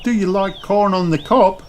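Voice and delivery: high friendly mum voice; Missus Kangaroo. A narrator's reading. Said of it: "asks Missus Kangaroo".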